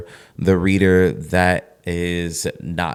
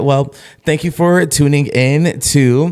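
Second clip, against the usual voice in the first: announcer voice; 6 semitones higher, 4 dB louder, slower than usual. Acted. Podcast outro announcement.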